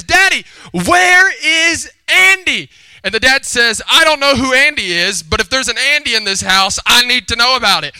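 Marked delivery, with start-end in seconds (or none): 0.0-2.7 put-on very high, slow, loud child's wail; demanding little girl. Little girl gets to her dad, demanding loudly. 3.9-7.9 raised, loud fatherly demand; mock alarmed dad. Dad answers his daughter, loud and demanding. Dad reacting to another man.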